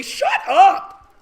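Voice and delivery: high loud snap; mock exasperated yell. Real, not acted. Teacher ranting at dog owners, loud yell. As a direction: cut them off.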